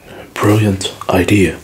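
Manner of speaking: usual speaking voice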